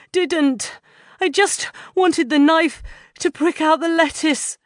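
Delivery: high, breathless panting plea; frightened man panting his excuse. A narrator's reading. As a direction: pant it out, scared. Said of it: "Martin pants".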